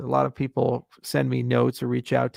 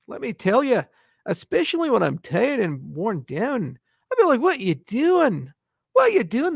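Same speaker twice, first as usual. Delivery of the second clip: high, swooping hammed-up Scottish burr; Scottish accent; 4 semitones higher than usual. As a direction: ham up a Scotsman with big pitch swings. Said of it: "Please try a Scottish accent".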